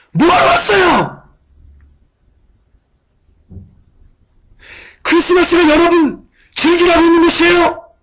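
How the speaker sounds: very high, loud indignant yell, slow; reenacted shout. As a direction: shout down the laughing students in outrage. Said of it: "제가 소리쳤어요". (아주 높고 크게 분노하며 외치기)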